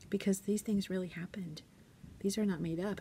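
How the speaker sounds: hushed serious telling. Retold or imitated. Teacher telling kids a hard truth.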